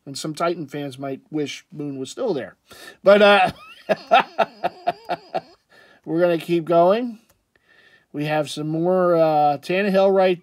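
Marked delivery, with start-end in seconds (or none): 3.9-5.4 high-pitched run of laughter; laughing. Real, not acted. Vlogger amused by his own aside, laughing. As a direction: laugh in a quick high burst. Vlogger chuckles before moving on.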